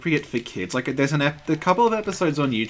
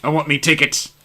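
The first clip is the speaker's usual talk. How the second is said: loud, pushy puppet voice; hammed-up greedy puppet shout; Agro demanding tickets; 8 dB louder than usual. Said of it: "And it's just Agro going".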